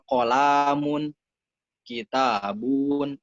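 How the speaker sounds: kolamun, kitabun in a slow drawn-out chant. Retold or imitated. Imitating children reading Arabic, kolamun and kitabun drawn out. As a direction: stretch the vowels of kolamun and kitabun slowly.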